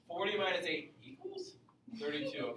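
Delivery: "equals" lifted in a questioning rise before "thirty-two"; mock question inflection. Shown as a demonstration. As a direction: ask it like you're unsure, rising on "equals". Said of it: "I like to make a joke about that".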